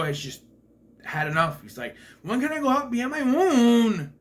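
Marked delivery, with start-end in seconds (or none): 2.2-4.1 high, loud mock whine; whiny puppet. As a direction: imitate a fed-up puppet begging. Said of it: "That's how he says it".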